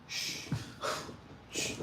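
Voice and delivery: hushing. Retold or imitated. Hushing at the gym.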